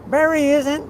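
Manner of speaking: usual speaking voice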